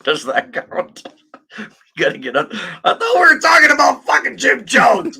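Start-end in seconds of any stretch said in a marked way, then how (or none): 2.5-5.2 much higher and louder, cracking with laughter; laughing shout. Real, not acted. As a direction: yell it through real laughter at the mix-up. Laughing outburst at own mixup.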